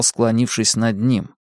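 usual speaking voice

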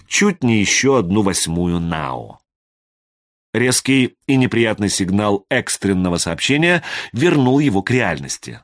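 ordinary delivery, nothing marked

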